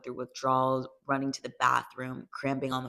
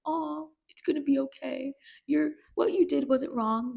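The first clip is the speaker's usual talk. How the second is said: high, sugary pretend comforting; mock soothing reassurance; 12 semitones higher than usual. Voicing the comforting mom and sister Cassie never gets, mock sweet and high. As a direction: coo it like a doting mom brushing off blame.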